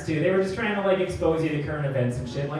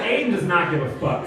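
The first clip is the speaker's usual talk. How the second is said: normal talking voice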